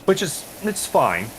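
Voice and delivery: high and slow, dripping sarcasm; drawn-out grudging fine; sarcastic it's fine. Real, not acted. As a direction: say fine like it isn't fine at all.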